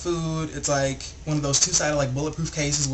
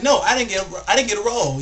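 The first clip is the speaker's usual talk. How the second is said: mildly heated customer protest, louder; higher-pitched mock indignation; arguing; 4 semitones higher, 4 dB louder than usual. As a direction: imitate a customer insisting he was shorted. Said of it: "they just start getting into it".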